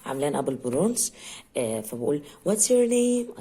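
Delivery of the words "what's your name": bright high classroom question; louder, higher teacher voice. Shown as a demonstration. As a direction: ask it like a teacher to a small child.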